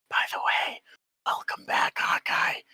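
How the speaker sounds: hushed stage whisper. Real, not acted. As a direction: whisper it aside to the table. GM aside to absent player.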